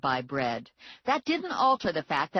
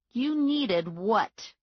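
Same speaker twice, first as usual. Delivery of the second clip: suspicious, drawn out; 7 semitones higher, 3 dB louder, much slower than usual. A narrator's reading. Narrowed my eyes at him.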